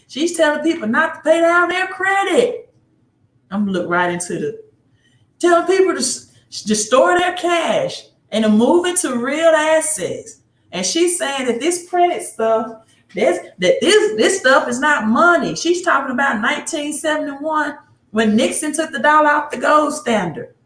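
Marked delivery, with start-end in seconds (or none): 0.1-2.7 much higher, hammed-up Mississippi accent; mock a woman in a thick Mississippi accent. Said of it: "That's my Mississippi standard accent". 5.4-20.5 much higher, hammed-up Mississippi accent; mock a woman in a thick Mississippi accent. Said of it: "That's my Mississippi standard accent".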